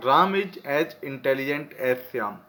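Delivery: teacher dictation pace; dictating slowly. Shown as a demonstration. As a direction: read it out slowly for students to copy. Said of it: "अब आपको पहचानना है".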